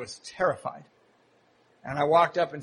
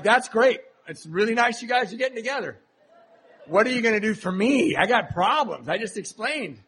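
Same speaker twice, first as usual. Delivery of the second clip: self-absorbed whine; 5 semitones higher than usual. Retold or imitated. Retelling his self-absorbed reply.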